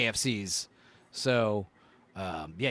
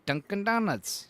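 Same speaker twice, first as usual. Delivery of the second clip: Dunkin' Donuts in a higher, savouring lilt; fun to say; 6 semitones higher than usual. Shown as a demonstration. Host saying the name again for fun, high playful lilt. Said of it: "it's fun to say".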